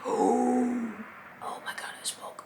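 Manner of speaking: whispered oh my God; a breathless, shocked whisper. Retold or imitated. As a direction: hush it, horrified at herself. Shocked that she spoke.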